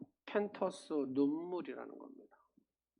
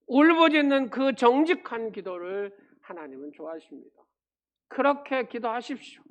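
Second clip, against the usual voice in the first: loud, higher pulpit preaching at full force; fervent preaching; 5 semitones higher, 10 dB louder than usual. Acted. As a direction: press the message home to the congregation. Preaching climax to congregation.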